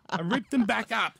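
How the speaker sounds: normal talking voice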